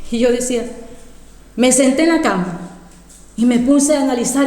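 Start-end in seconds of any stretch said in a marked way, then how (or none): none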